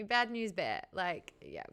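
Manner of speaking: usual speaking voice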